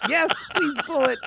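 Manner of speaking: pleading. Acted. Pleading with teammate to pull.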